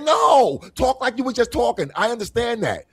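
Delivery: high-pitched shouted "no", easing off; exasperated protest. Acted. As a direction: cry out in acted exasperation, then insist. Acted exasperated demand to talk normally.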